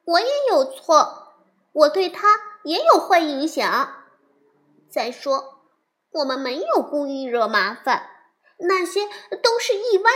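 put-on high and loud, a child arguing back; little bear pleading that it was an accident; upset little girl voice. Sister Bear pleading her case. (小女孩激动地辩解)

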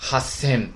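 八千 as Japanese "hassen", held double s; geminate consonant. Shown as a demonstration. Teacher shows students the special eight thousand, double s in 八千. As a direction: say 八千 as "hassen", catching before the s. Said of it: "促音变了".

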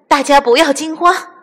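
high, loud call for calm; rally a frightened crowd; shouted order. A narrator's reading. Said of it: "大喊道".

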